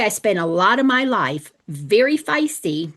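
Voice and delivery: ordinary talk, nothing marked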